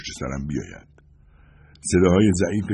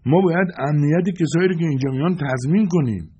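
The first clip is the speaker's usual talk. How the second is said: high-pitched, stubborn insistence; 10 semitones higher, 4 dB louder than usual. A narrator's reading. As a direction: insist obstinately in a raised pitch. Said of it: "صدا با لجاجت گفت". (با لجاجت و صدای زیر)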